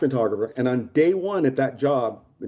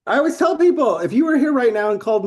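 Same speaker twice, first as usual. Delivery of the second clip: much higher, louder launch; fired up; 11 semitones higher, 4 dB louder than usual. Real, not acted. Bragging about never being normal, excited. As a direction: kick off the rant with energy.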